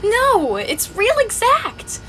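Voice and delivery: high, slow, hurt insistence; rising in pitch, pressing the point; upset protest. Acted. Hannah accusing her friend of copying her life, upset.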